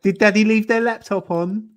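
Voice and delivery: high-pitched patronising question; mock baby talk. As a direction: tease as if talking to a little child. Mocking a commenter as a child.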